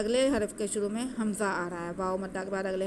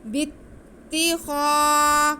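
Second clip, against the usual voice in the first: slow, drawn-out melodic tajweed recitation, higher and louder; Quran recitation; 5 semitones higher, 6 dB louder, much slower than usual. Shown as a demonstration. Teacher demonstrating the recitation to students, slow melodic chant. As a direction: chant it slowly with nasal ghunna. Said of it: "इसको हम खींच के पढ़ेंगे".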